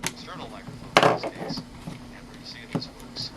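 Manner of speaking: distant, muffled cassette recording; played back faintly from tape; faint tape playback. Shown as a demonstration. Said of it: "you can barely hear it".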